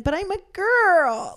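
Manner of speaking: put-on high, loud, slow girly voice; mock girly. Woman mocking the guys with an exaggerated girly voice. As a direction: stretch it out high and coy. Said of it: "And then I'll be like".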